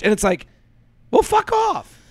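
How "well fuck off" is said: squeaky high mock snap; high-pitched sarcastic brush-off; mocking retort. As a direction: toss it off in a shrill mocking squeak.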